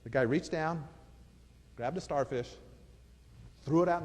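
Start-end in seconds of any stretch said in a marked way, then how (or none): none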